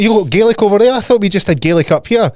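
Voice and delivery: high, loud incredulous outburst; retold astonishment. Scotsman learning the Irish have Gaelic too, astonished. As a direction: act out comic amazement, starting loud and high.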